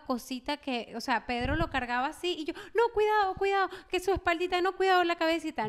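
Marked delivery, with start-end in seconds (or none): 2.7-5.7 high, anxious warning; retold fussing, pitched up in alarm; madre sobreprotectora. Retells her own overprotective warnings. (advertencia angustiada, en tono agudo)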